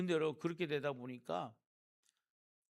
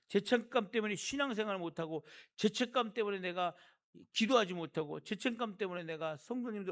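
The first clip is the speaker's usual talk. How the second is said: rising preaching; 6 semitones higher, 4 dB louder than usual. Real, not acted. Preaching climax.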